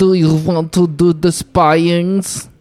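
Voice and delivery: very slow mock Russian spy; hammed-up Red Sparrow Russian accent; bad Russian accent. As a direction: draw out every word in a fake Russian accent. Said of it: "A bad accent".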